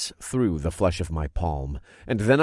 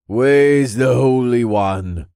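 loud, slow monotone voice; 5 semitones higher, 8 dB louder, much slower than usual. A narrator's reading. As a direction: drone it out, loud and flat. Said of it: "he had a slightly monotone voice".

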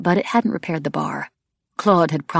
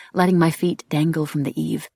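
plain reading voice

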